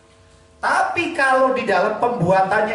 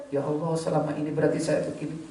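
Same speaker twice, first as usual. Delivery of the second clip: soft, quick rueful prayer; regretful murmur; 3 semitones lower, 9 dB quieter, faster than usual. Acted. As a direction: murmur the realization to God. Voicing a regretful worshipper.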